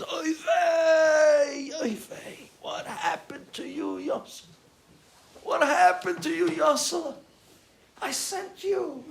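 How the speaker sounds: high, drawn-out wail of dismay; anguished lament. Retold or imitated. Father finally recognizing his fallen son, high anguished wailing. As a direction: wail from hushed shock up to loud grief.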